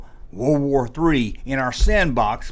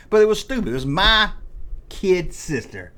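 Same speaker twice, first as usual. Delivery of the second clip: usual speaking voice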